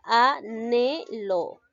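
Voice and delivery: anhelo drawn out very slowly as a-nhe-lo, the H silent; anhelo, muy despacio, a-ne-lo; slow syllable demo. Shown as a demonstration. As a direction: spell out anhelo slowly, the N joined to the E. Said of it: "Se pronuncia así, de esta manera".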